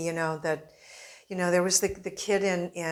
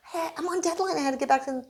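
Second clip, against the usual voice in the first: higher, brushing it off lightly; a breezy excuse, pitched up; mock defensive self; 10 semitones higher, faster than usual. Reporter telling Fernando why he left. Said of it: "And I said".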